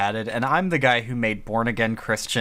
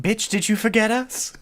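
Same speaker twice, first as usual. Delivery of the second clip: sassy quote; 10 semitones higher than usual. Retold or imitated. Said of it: "they actually went".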